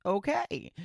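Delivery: ordinary talk, nothing marked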